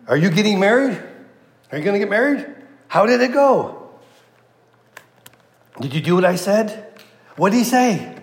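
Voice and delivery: high, rushed, eager questions; bursting with questions for her daughter-in-law; excited Naomi. Retold or imitated. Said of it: "Naomi's like".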